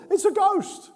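sudden high, loud cry of fear; terrified cry. Acted. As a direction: shriek it like you've seen a ghost.

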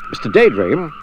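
ordinary delivery

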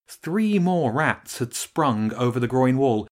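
ordinary delivery

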